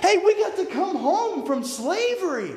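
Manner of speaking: high, loud, laughing cheer; acted joyful exiles laughing; excited. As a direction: whoop with joy at coming home. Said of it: "boy, they're excited".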